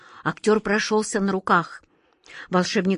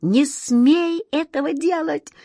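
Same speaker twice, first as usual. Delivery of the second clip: high, stretched, despairing plea; desperate cry; 9 semitones higher, 3 dB louder, much slower than usual. A narrator's reading. Wizard forbidding the genie to obey, desperate exclamation. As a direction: cry it out helplessly, rising higher on the repeat. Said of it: "в отчаянии воскликнул волшебник".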